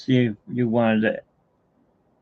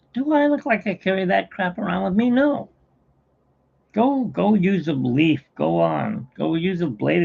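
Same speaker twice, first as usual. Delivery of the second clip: higher, exasperated scolding; pitched-up mock annoyance; exasperated joke voice; 7 semitones higher than usual. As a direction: brush him off, fed up. Wop scolds Pollock in joke.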